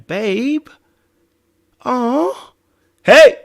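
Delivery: high coo building to a loud call; imitated fussing partner. Partner fussing over a crier, then snapped at.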